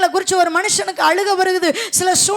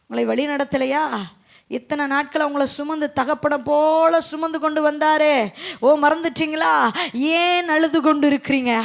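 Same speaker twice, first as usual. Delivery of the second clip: ordinary talk, nothing marked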